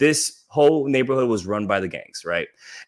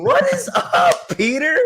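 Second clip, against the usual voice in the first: thrilled hello to a new Australian listener; excited shout-out; 12 semitones higher than usual. Real, not acted. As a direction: squeal a delighted hello to a fan.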